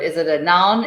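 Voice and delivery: ordinary delivery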